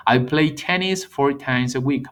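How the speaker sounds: slow, deliberate, every word clear; slow model sentence. Shown as a demonstration. Teacher showing present simple, slow and clear. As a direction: lay it out slowly for learners. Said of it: "Vean la diferencia".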